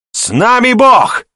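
very high, loud, drawn-out bellow; roared war cry. A narrator's reading. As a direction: roar the slogan to lead the crowd. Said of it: "вновь рявкнул он". (рявкнуть боевой клич)

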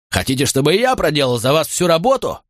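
high, scornful shout; angry yell. Acted. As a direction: shame the men into action. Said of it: "заорал Макрон". (презрительный крик)

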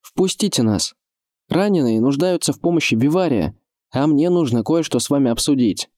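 high, carrying request; young lord's appeal. A narrator's reading. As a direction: appeal up to the gatekeepers. Adam appeals to men on wall.

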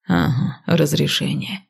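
low, slow scornful repeat; mocking. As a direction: sneer the word разрешение. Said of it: "хмыкнул он насмешливо".

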